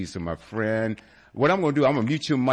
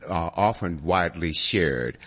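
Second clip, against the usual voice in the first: normal talking voice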